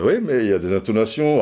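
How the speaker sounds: ordinary talk, nothing marked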